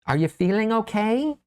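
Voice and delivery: high little mouse voice. Acted. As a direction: voice the tiny mouse, pitched high. Mouse asks with concern.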